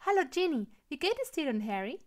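lively, lifted question; cheery greeting. A narrator's reading. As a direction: greet a dear friend eagerly. Happy greeting between friends.

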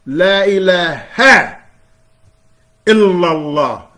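La ilaha, illallah, slow, high and loud; slow ringing proclamation; emphatic recitation. Shown as a demonstration. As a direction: declare it slowly, each word weighted. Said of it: "Illa means except".

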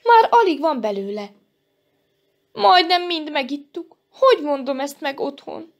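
worried, high-pitched lament; frightened. A narrator's reading. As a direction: fret in rising alarm. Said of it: "ijedt meg Janka".

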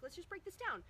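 bright, eager step-by-step coaching; upbeat coaching voice. Retold or imitated. Acting out coaching steps.

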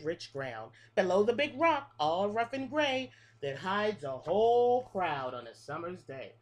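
lilting picture-book read-aloud, each line rising then falling; reading to children. Acted. Teacher reading a bug rhyme book to her class, sing-song. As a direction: read the rhyme like story time for little kids.

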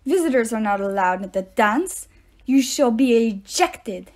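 put-on stern, loud French-accented teacher, the last words slowed. A narrator's reading. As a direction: lay down the law with a light French accent. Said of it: "He had an accent. French, maybe?".

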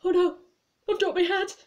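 comic high-pitched monster fretting; Babadook. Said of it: "all I could see was the Babadook going".

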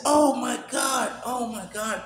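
high, slow joyful cry of disbelief; a father shocked to hear his lost daughter; overjoyed. Real, not acted. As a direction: let the joy burst out, high and drawn out. Daughter calls after long silence.

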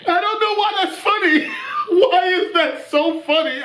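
high-pitched, helpless laughter through words; laughing. Real, not acted. Baffled at finding a meme funny, laughing. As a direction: talk through real laughter.

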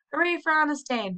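happy shout; crowd cheer. A narrator's reading. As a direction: cry it out with the crowd. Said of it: "they cried".

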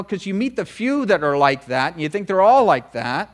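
ordinary talk, nothing marked

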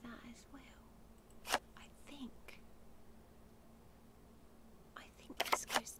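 soft ASMR whisper. Real, not acted. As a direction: keep it an intimate breathy whisper. Returns to whispered puzzle chat.

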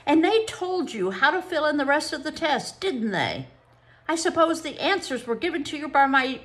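triumphant accuser; accusing psychologist. Acted. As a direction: expose the trick triumphantly.

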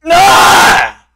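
high, loud scream of rage; frustrated scream. Real, not acted. Gamer screaming at the game, then catching himself. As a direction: let the scream rip. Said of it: "I need to stop screaming".

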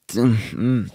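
normal talking voice

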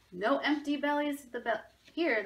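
booming baker's bellow. A narrator's reading. Baker bellowing to hungry children, loud and hearty. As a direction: bellow it out big and generous. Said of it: "the baker bellowed".

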